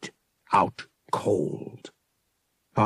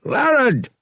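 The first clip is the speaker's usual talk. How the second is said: loud, high yell to his brother; 7 dB louder than usual. Acted. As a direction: call out to the fallen brother. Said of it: "he yelled".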